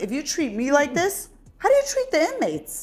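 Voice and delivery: re-enacted indignation climbing very high; indignant challenge. Retelling a complaint to the warden, high indignant challenge. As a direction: push the question up into high-pitched outrage.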